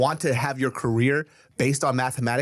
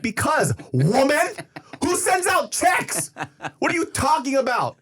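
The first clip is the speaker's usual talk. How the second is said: exasperated retort; 14 semitones higher, slower than usual. Retold or imitated. Mocks his mom's suggestion.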